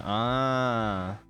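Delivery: normal talking voice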